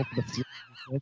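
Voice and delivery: high distant echoing voice; dreamy far-off flashback echo. Pretend. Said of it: "He's doing the flashback voice".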